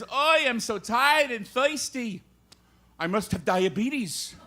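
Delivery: put-on kvetch it slowly in an old-time Yiddish accent.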